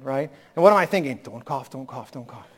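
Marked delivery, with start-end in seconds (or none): 1.2-2.5 softer anxious thought. Retold or imitated. Retold inner thought at wedding.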